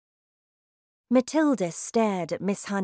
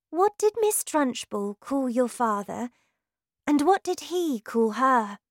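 put-on young girl; 6 semitones higher than usual. A narrator's reading. Girl character voiced by narrator.

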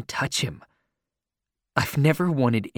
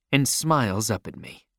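usual speaking voice

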